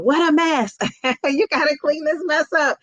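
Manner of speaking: high, scolding, with a laugh; nagging mom scolding. Retold or imitated. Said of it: "I say this to my daughter in her room".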